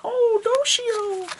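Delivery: very high, slow, girly put-on voice; Naka's voice. Naka calling for help in battle, very high girly squeak. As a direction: squeak it like a helpless idol. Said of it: "Naka-chan's like".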